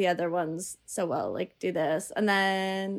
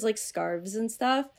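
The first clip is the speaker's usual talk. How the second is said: normal talking voice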